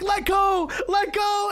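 high, loud shouted instruction; acting out onlookers yelling up a hill; urgent calling out. Kids call to the skier stuck on the rope, urgent shout.

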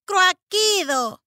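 high, slow, offended caw; crow-speak; indignant chirp. Acted. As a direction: squawk in protest. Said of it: "chirrió Jack indignado".

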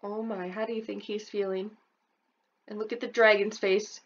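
plain reading voice

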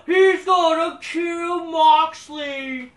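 drawn-out mocking promo voice; mock wrestler threat. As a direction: ham up a foreign wrestler's boast.

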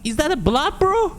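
very high, squeaky shock; high alarmed disbelief. Real, not acted. Player asking bro about blood, high alarmed disbelief.

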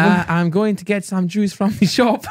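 slow, high mock Welsh accent; over-careful put-on Welsh accent. Shown as a demonstration. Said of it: "I can do Welsh".

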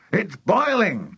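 high, drawn-out excited exclamation; excited cry. A narrator's reading. Mister Cranky exclaims over the bubbling saucepan, excited cry. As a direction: cry it out, stretching the word boiling. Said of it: "cried Mister Cranky".

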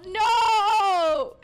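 shouted refusal; revolted by the cellmate's smell; loud no. Real, not acted. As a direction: yell it out in disgust. Can't handle the smell.

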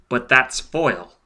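ordinary delivery, nothing marked

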